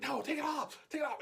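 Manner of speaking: high, urgent mock yelling; retold panicked shout. To sister holding hot spatula, high urgent shout. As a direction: yell it like you're getting burned. Retold plea while being burned.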